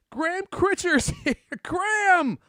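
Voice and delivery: high, loud delight; excited greeting. Real, not acted. Greeting an old buddy who arrives.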